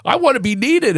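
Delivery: high, pleading whine; whiny high plea. Pretend. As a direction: whine it like a needy parent.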